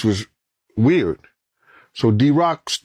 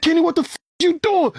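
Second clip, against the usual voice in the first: high, alarmed cry at the driver; panicked yell; faster than usual. Retold or imitated. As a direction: caught off guard as shots ring out. Panic during shooting.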